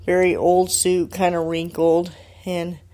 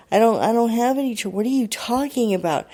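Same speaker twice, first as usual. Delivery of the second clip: higher-pitched indignant denial; retelling a confused, defensive reply; baffled retold protest; 5 semitones higher than usual. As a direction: act out bewildered protest, pitch lifted.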